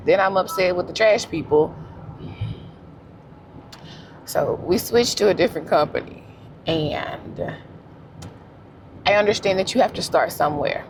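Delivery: ordinary talk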